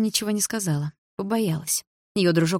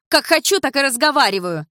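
higher, louder defiant cut-off; curt retort; 8 semitones higher, 5 dB louder than usual. A narrator's reading. Дилара cuts the woman off, high and loud. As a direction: cut her off sharply. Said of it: "отрезала Дилара".

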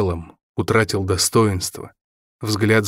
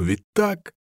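ordinary delivery, nothing marked

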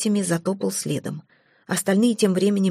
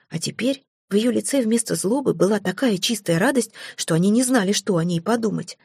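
ordinary delivery, nothing marked

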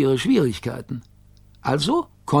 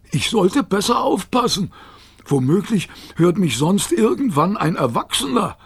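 higher, fretting dog voice; worried talking dog; 6 semitones higher than usual. A narrator's reading. Rover worrying that grown-ups might hear, higher voice. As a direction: fret aloud, pitched up.

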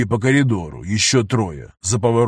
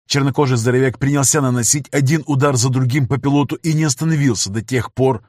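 ordinary delivery, nothing marked

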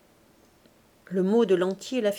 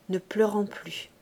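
ordinary delivery

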